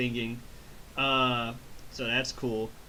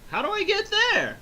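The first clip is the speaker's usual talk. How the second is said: much higher dopey voice; dim Goku; 5 dB louder than usual. Retold or imitated. Goku asking King Kai, high dopey voice. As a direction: ask like a clueless kid.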